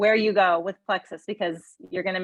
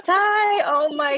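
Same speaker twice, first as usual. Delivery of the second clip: excited exclamation; 9 semitones higher, 5 dB louder, slower than usual. Real, not acted. Host reacts to story.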